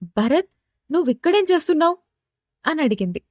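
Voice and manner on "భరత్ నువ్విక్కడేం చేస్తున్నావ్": quick, high-pitched surprised question. Acted. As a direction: rush the question out in acted astonishment. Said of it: "అంతే ఆశ్చర్యంగా".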